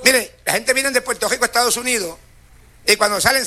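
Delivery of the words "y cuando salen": ordinary delivery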